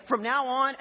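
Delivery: loud, high, emphatic proclamation; mock declaration. Husband walks back in to wife, declaring grandly. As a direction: proclaim it like a new household law. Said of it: "and I declared".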